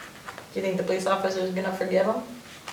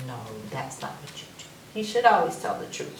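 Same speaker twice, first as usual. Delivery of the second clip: ordinary delivery, nothing marked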